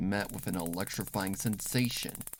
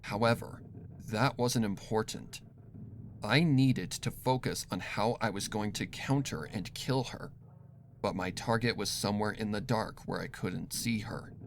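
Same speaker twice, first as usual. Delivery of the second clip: ordinary delivery, nothing marked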